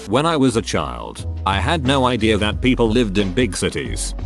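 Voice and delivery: ordinary delivery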